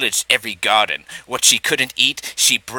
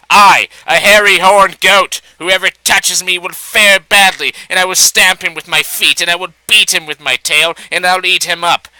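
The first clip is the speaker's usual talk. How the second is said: loud, higher blustering threat from a goat; loud shout; 5 semitones higher, 7 dB louder than usual. A narrator's reading. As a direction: bellow it to scare off the fox. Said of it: "she shouted loudly".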